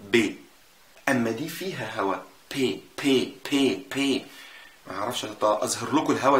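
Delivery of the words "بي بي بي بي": بي بي with no puff of air; unaspirated B sounds. Shown as a demonstration. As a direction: say بي بي with a voiced B and no breath. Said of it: "دي ما بيطلعش فيها هوا خالص". (بي بي من غير هوا)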